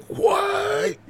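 high, loud incredulous "what"; hammed-up shocked yelp; mock shock. Mock outburst at demon.

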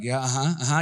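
low bored uh-huh; brush-off agreement; dismissive. Acted. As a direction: humor them without listening. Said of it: "they're like".

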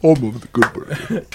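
slow dubbed movie voice; mildly parodied English dubbing; a hint of a dubbed film actor; Bruce Lee. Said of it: "He was English dubbed".

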